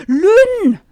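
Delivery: high, loud gleeful name call; rejoicing. Acted. Rosi greeting Lynn gleefully, high and loud. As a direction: sing the name out with glee. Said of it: "frohlockte Rosi".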